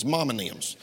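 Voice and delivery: "Mom and Thems" run together, low and soft; Georgia dialect word. Shown as a demonstration. Preacher repeats his Georgia word, Georgia dialect word. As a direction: drop the voice and slur "Mom and Thems" into one word. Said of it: "That's Mama and Thems".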